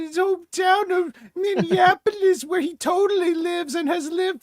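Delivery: high, mock-sincere sarcastic voice; hammed-up troll post read aloud. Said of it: "That sounds like an A I Twitter troll voice".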